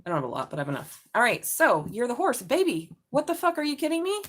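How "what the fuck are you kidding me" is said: much higher, disbelieving outburst; shocked surprise. Real, not acted. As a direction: react as if the card floored you. Reacting to the drawn cards.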